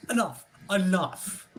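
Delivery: loud, cutting stop; firm angry. Acted. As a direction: bark it to end the fuss. Watch stained, stops Willow's fussing.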